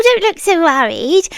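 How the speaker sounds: ordinary delivery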